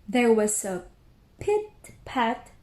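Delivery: ordinary delivery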